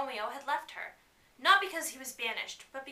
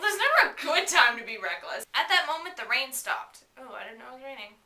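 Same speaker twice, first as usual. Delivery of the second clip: plain reading voice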